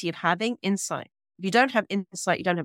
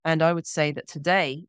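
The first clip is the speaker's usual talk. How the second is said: ordinary talk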